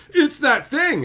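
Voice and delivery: high, loud pointing taunt; mock gotcha. Imitating the gotcha pointing at a liked thing, high and loud. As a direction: jab it smugly. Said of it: "point to something you like and go".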